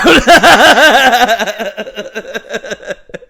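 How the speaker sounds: long high belly laugh; loud laughing fit. Real, not acted. Cracking up at the retold scene, loud laughter.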